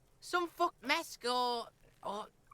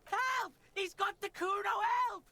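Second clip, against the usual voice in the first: high hammy calls for help; mock cry for help; 4 semitones higher than usual. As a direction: play-act a panicked victim. Voicing Kuno calling for help.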